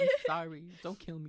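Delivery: giggly, light sorry; laughing apology. Real, not acted. Apologizing while laughing about the story.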